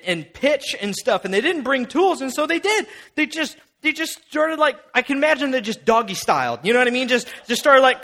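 normal talking voice

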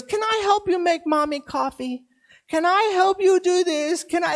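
put-on high, eager small-child voice; a toddler begging at the bedside; little boy voice. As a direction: voice the three-year-old asking his dad. Son asking to help.

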